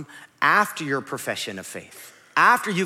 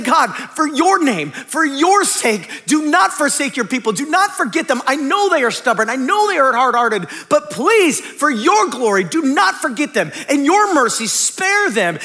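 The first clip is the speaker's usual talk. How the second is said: earnest plea; 7 semitones higher, 5 dB louder than usual. Acted. Moses interceding for the people.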